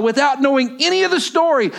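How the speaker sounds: high, loud, building scripture reading; preaching scripture at full force. Acted. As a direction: proclaim the verses to the congregation.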